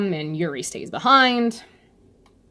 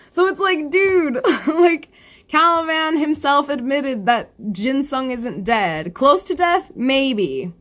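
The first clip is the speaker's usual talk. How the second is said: dude, come on, he's not dead; exasperated; 7 semitones higher, slower than usual. Real, not acted. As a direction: throw up your hands at the story. Exasperated ranting at the story.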